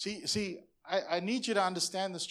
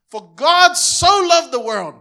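louder, slow, full-force proclamation; fervent preaching; 8 semitones higher, 18 dB louder, slower than usual. Real, not acted. Pastor proclaiming John three sixteen to congregation, slow loud fervor. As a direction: proclaim it slowly with all your passion.

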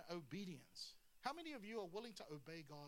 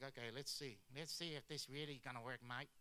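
put-on low, nasal Aussie accent; Australian accent; 8 semitones lower than usual. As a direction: put on your best comic Australian mate voice. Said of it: "that's my best Australian accent".

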